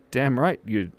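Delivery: usual speaking voice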